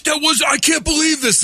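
high, shouted disbelief; outraged shout. Retold or imitated. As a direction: yell at the bad call. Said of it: "she's like".

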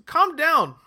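loud, high calm down; mock exasperation at too many R names; exasperated. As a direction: throw up your hands at the names.

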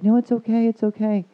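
high-pitched mock consoling; imitated urgent reassurance. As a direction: retell the doctor soothing someone in tears, high and insistent. Doctor consoling the crying patient, retold.

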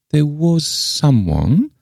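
put-on high, slow, sly old lady; cunning. A narrator's reading. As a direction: draw it out craftily, pitched high. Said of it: "answered Miss Le Fane cunningly".

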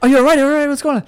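high, loud panicked check-in; alarmed players. Retold or imitated. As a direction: shout in alarm. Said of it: "everyone's like".

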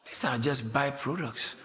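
normal talking voice

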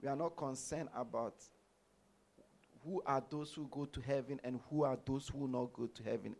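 normal talking voice

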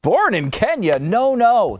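high, loud incredulous question then quick denial; mock surprise. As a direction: play it as a fake gasp.

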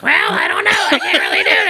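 very high, loud mock-wife squeal; mock a helpless wife in a squeaky voice; cartoonish clueless whine; Catherine. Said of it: "mine for Catherine is like".

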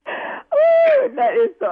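high, loud shriek of relief; loud exclaim. Real, not acted. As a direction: let out a loud shocked whoop. Reacting to the prank reveal.

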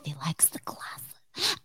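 slow whisper; hushed aside. Real, not acted. Podcaster dedicating the toast to someone, whispered. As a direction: drop to a whispered, unhurried aside.

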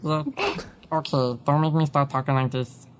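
a hint of a gooey ghost voice; lightly voiced old ghost grumble; grandpa Slimer voice. Teasing co-host, threatening to keep talking like Slimer. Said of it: "That is Slimer".

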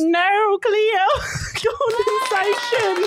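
high-pitched, hammed-up Australian accent. Shown as a demonstration. Host showing off the voice on request, bad Australian accent. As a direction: put on a silly, squealing Aussie accent. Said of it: "I was doing an Australian accent".

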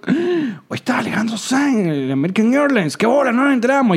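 high-pitched, astonished reenactment; retold surprise. Host retelling news of a missed concert, astonished. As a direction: act out finding out too late, pitch leaping up.

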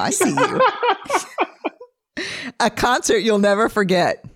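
high, laughing through the words. Real, not acted. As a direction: laugh as you ask. Laughs while asking questions.

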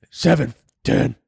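strained superhero growl; hammed-up masked vigilante voice; Batman. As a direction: growl out the episode number. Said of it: "in the Batman voice".

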